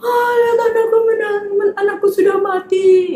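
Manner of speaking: put-on mother crying out that her child is dead; wailing mother. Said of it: "istrinya Bapak Yairus juga nangis".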